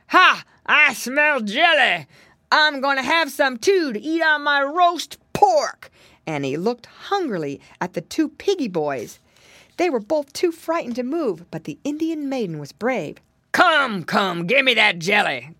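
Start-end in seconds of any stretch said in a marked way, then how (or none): 0.1-5.9 loud, slow, higher-pitched growly bear; growling bear. A narrator's reading. Bear eyeing jelly and piggy boys, growling. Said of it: "he growled out". 13.5-15.6 loud growly bear demand; growling, grumbling bear. A narrator's reading. Bear demanding jelly from the maiden, growling. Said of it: "growled and grumbled the bear".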